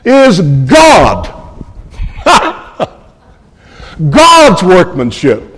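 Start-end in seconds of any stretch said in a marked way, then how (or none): 2.0-2.5 brief high chuckle; a quick laugh to himself; short delighted chuckle. Real, not acted. Preacher delighted by the Greek word order, short chuckle. 4.1-5.5 front-load the weight onto "God's"; stress on God's. Shown as a demonstration. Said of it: "a word by front-loading it".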